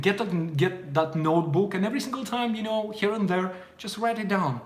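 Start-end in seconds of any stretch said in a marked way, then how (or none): none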